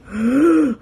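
short strained mock gasp; playful strained gasp. Retold struggle to hold it.

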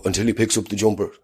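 ordinary talk, nothing marked

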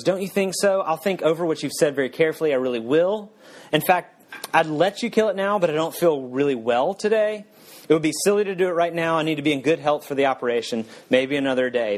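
high, hurried, anxious ghost; nervous excuses. Acted. Ghost putting the angel off until another day, high and hurried.

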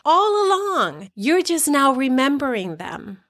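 put-on high, bright little kid voice, eager and relieved; excited child voice. Inner child cheering to the listener, high and excited. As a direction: play the inner child cheering that it's finally back. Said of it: "your inner child's going".

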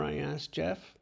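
usual speaking voice

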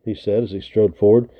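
usual speaking voice

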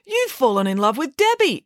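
high-pitched astonished exclamation; incredulous, voice lifted; exclaiming. A narrator's reading. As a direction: blurt it out in disbelief. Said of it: "exclaimed Friday".

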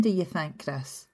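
normal talking voice

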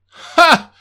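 loud high scoffing ha; scornful laugh. Acted. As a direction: bark a mocking ha. Boss scoffs.

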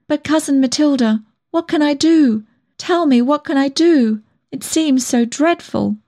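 high, distressed pleading; miserable. A narrator's reading. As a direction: beg for guidance, wretched and high-pitched. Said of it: "I said miserably".

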